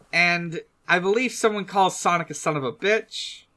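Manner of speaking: normal talking voice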